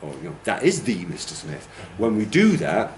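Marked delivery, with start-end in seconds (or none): 0.4-1.6 THE as a full, stressed "thee" after "is"; emphasis on THE. Shown as a demonstration. Teacher confirming the one and only, THE stressed. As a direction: give "the" weight as "THEE". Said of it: "when we put that emphasis on it".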